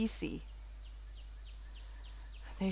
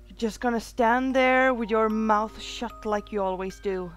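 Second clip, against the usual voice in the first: louder, accusing; bitter, raised-voice accusation; angry reproach; 6 dB louder than usual. Acted. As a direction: lash out at someone who won't speak. Angry reproach at silent Holly.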